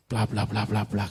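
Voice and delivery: low, soft blah-blah mumble; imitated droning. Preacher skips rest of pastor's speech, low droning. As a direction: skim the rest in a low monotone.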